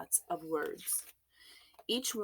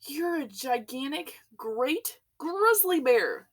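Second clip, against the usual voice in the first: higher, louder, slow and drawn out; shout; 9 semitones higher, 7 dB louder, slower than usual. A narrator's reading. Chief of police confronting the bear, slow shout. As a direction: hammer each word as an angry charge. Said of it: "shouted the chief of police".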